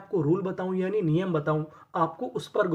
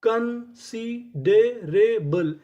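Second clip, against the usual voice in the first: Considerable stretched very slowly, syllable by syllable; slow drawn-out reveal; 3 semitones higher, 3 dB louder, much slower than usual. Shown as a demonstration. Teacher revealing the full word to students, very slow. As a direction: draw out considerable slowly for the class. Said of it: "तो पूरी meaning क्या बनी?".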